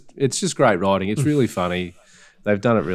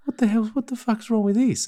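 much higher, exasperated gangster yelp; mock angry outburst; 12 semitones higher than usual. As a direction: fling it out like a fed-up crook cursing his gun. Retold gangster cursing at jammed gun.

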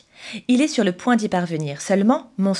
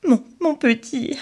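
sobbing; 5 semitones higher than usual. Acted. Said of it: "sanglote-t-il".

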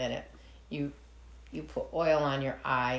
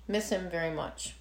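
teary-eyed; 7 dB quieter than usual. Real, not acted. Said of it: "get a little teary-eyed there".

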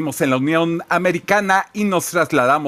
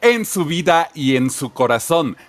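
announcer voice; 4 semitones higher than usual. Acted. Announcer sign-off.

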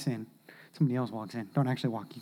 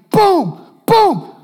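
repeated shouted booms, much higher and louder; mock crashes; 9 semitones higher, 10 dB louder than usual. Preacher acting out the log hitting his kids, shouted booms. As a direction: punch each boom out like a hit.

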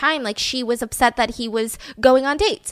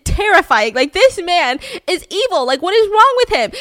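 higher, louder frightened rush; panicked; 6 semitones higher, 6 dB louder than usual. Retold or imitated. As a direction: panic about the shock therapy. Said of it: "So Mary's like".

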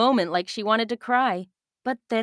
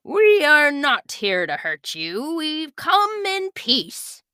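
captain's careful greeting; 7 semitones higher, 3 dB louder, slower than usual. Acted. Captain greets island strangers.

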